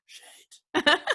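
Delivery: high, loud Belfast "Shite"; imitating him muttering a verdict; a co-judge dismissing a competitor in a Belfast accent. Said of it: "given me a bit of side eye ... gone".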